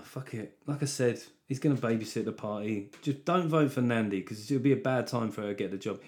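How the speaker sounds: usual speaking voice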